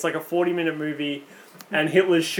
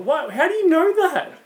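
high-pitched disbelieving question; astonished; 11 semitones higher than usual. Real, not acted. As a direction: blurt it out in high-pitched surprise. Astonished at friend's knowing the song.

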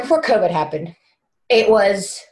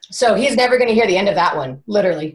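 ordinary talk, nothing marked

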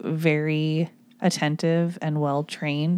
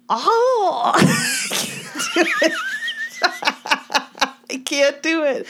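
failed Barney Gumble; 11 semitones higher, slower than usual. Retold or imitated. Attempting a cartoon drunk, she dissolves into high laughter. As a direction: burst into laughter mid-attempt, voice cracking high. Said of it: "give me your best Barney Gumble".

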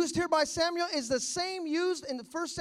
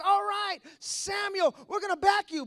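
loud, high declaration of support; rallying crowd; 5 semitones higher, 4 dB louder than usual. Acted. Israel pledging to back its ageing leader, loud and rallying. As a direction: call it out as a crowd's spokesman.

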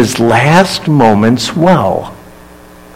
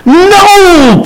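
protesting no. Acted. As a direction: burst out with a high cry of no. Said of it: "Peter protested".

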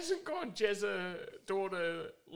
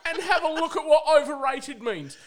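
louder, higher, fed-up rant; indignant outburst; 7 semitones higher, 10 dB louder than usual. Real, not acted. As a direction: blast the idea as ridiculous. Indignant rant about the comments.

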